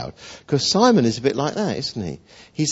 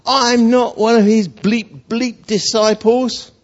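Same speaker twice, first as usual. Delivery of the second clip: loud, high, slow outburst; exasperated denial; 8 semitones higher, 4 dB louder, slower than usual. Acted. Simon curses at the servant accusing him, exasperated denial. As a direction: deny it hotly, hammering each bleeped curse.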